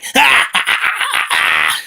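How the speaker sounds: long, low villain cackle; low hammed-up evil laughter. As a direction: laugh like a triumphant baddie. Villain gloats after his threat.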